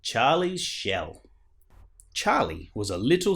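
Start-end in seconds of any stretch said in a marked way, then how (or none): none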